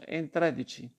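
usual speaking voice

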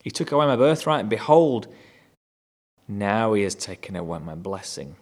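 bothered outcry. Retold or imitated. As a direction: lean into the injustice. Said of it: "now he seems a bit more bothered".

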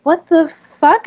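high, disbelieving What the fuck, pitch jumping up; incredulous. Retold or imitated. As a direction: let your voice shoot up in disbelief. Disbelief at the homeowner's demand.

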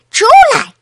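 sudden high-pitched shout; loud roar. A narrator's reading. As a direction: bellow it as a child's order. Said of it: "劳拉大吼一声".

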